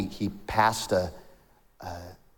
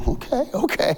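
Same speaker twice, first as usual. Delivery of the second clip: taken aback; 12 semitones higher than usual. Acted. Man intimidated by the manager.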